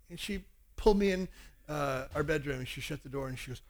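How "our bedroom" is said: ordinary talk, nothing marked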